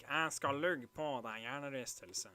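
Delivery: nasal Narvik accent on a Norwegian insult; demonstrated peculiar Narvik accent. Shown as a demonstration. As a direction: say it through the nose, Narvik style. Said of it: "in the peculiar accent from Narvik".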